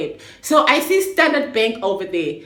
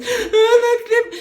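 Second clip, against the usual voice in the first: tearful plea; 13 semitones higher, 4 dB louder, slower than usual. Retold or imitated. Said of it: "now I'm crying".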